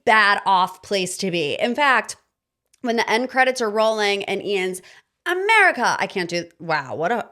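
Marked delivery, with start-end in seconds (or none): 5.2-6.0 a high, loud mock host-accent call of America; a playful hammed-up host's accent on America; imitating host's accent. Vlogger mimicking the Love Island host's accent. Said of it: "I try to do the accent".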